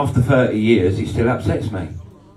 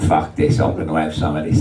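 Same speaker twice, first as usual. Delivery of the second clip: low gruff decision; 8 semitones lower than usual. Retold or imitated. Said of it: "I went".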